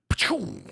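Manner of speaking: loud falling gunshot noise; imitated gunshot. Proving he is a better German, imitated gunshot. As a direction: fire a shot with the mouth.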